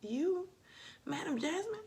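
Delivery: high, squeaky mock shock from viewers; mock disbelief. Imitating fans who can't believe it, mock surprise. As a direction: play the stunned fans. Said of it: "y'all be like".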